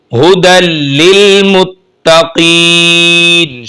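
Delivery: हुदल लिल मुत्तकीन recited slowly, the doubled ल in लिल pressed hard; firm doubled letter. Shown as a demonstration. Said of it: "शद वाले हर्फ को सख्ती के साथ पढ़ेंगे".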